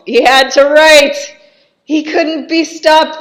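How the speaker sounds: high, shouted outburst, building in pitch; loud mock horror. Reviewer acting out horror at the dungeon walls, shouting. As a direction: exclaim it at full volume, hammed-up.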